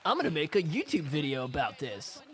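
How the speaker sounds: singsong mockery of a show-off; mock boasting. Commentator imitating the self-satisfied MVP, mock boasting. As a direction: play up the self-congratulation, pitch swinging upward. Mocks winner's boastful celebration.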